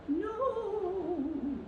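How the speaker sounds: high sung wail of no; a dramatic falling wail. Acted. Soaked man cries out as he tumbles into the sea.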